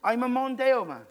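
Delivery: loud, high, proud Welsh bloke; booming Welsh pride in being ordinary; mock Welsh drunk. Drunk Welsh guest boasting to his mate in Welsh accent. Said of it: "that sounds Welsh".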